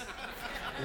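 a quick unvoiced laugh; soft laugh mid-boast; breathy chuckle. Laugh inside [name]'s boast.

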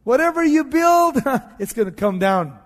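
high, loud jeer breaking into a laugh; imitated taunting outburst; mocking. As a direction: scoff at the builders with a laugh. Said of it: "He starts mocking".

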